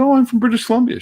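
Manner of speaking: high, put-on cheerful voice; bright imitated excitement at meeting a Canadian; eager retelling. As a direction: chirp it like a delighted tourist. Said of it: "I said".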